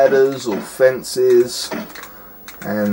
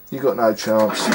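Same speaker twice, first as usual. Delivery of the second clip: ordinary talk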